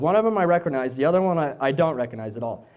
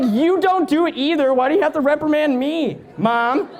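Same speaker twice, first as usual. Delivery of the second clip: put-on whiny child; 12 semitones higher, 4 dB louder than usual. Mocking a scolded child.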